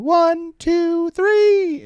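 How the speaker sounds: put-on very high little-girl count. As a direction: count push-ups like a tiny drill sergeant. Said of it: "And she starts going".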